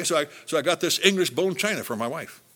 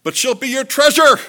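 high, loud, ringing delivery; booming punchline; 12 semitones higher, 13 dB louder than usual. Acted. Preacher springs the joke on the men, loud and high. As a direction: belt out the treasure line.

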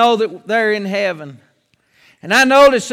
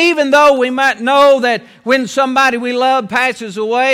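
ordinary talk

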